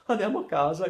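put-on a scared boy begging to go home; pleading. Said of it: "implorò". (supplica impaurita, più acuta e forte)

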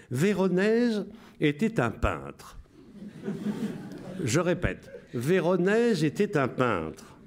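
amused wartime radio announcer. Shown as a demonstration. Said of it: "Je vous lis avec la voix".

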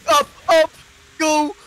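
very high, loud urgent yell; shouting. Real, not acted. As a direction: scream them upward. Panic in combat.

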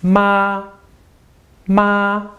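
"Ma, ma" each held high and level; first-tone "Ma, ma", no movement in pitch; high steady first tone. Shown as a demonstration. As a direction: sustain "Ma, ma" on one high straight pitch. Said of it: "just a straight line".

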